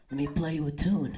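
low, hushed offer; soft, low imitation of a man; imitated lover. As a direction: murmur it low, as a late-night proposal. Man offers a song at night.

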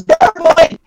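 high-pitched acted yell; game master's high yelled warning. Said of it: "and yells".

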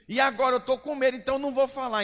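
high, loud, fast mocking whine; mock the frightened youth in a sing-song; whiny mock scared kid. Pastor mocks a scared young believer, high sing-song whine. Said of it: "Quem manda ter mentido?".

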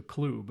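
"club" with a long oo vowel; "club" pronounced "kloob". Retold or imitated. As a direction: say "club" to rhyme with tube. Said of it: "that's a club".

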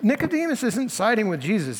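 ordinary talk, nothing marked

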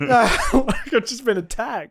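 cracking up while telling it; laughing. Real, not acted. Laughing at her own story.

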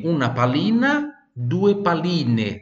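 "pallina" ending in A, then "palline" ending in E, said slow and clear; stress on endings. Shown as a demonstration. Teacher contrasts singular and plural for students. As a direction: mark the A and E endings. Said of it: "Tá vendo a diferença?".

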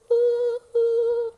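high sung choir voice; children's choir. Pretend. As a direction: sing high like a kids' choir. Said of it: "the chorus is a children's choir".

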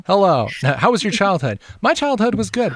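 put-on high, loud playful child voice; mock kid voice. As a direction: mimic the kids' odd way of talking. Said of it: "My kids always talk like this".

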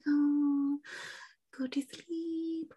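put-on high, hushed coo to a baby; soothing whisper. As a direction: whisper slowly, lulling a baby to sleep. Mother soothing baby before smothering it.

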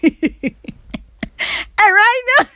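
high-pitched, very slow, words lost in laughter; can barely get it out for laughing; laughing hard. Real, not acted. Laughing while speaking.

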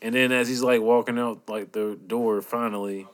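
usual speaking voice

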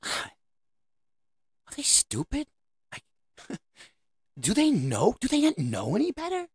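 mock-exasperated parent, pitch jumping high. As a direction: sigh over your kids as a fed-up parent. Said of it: "you look at your kids and go".

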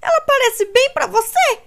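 high, loud incredulous retort; pained snap. A narrator's reading. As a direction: throw it back in pain. Kate snapping in pain.

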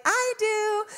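put-on high, eager small child; little girl's voice. Daughter piping up from back seat, little child's voice. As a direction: pipe up like a little kid. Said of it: "with her little voice".

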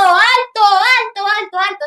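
high shouted alto, alto; urgent yelled alto; shouting stop. Retold or imitated. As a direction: call out to halt the cars. Yelling at traffic to stop.

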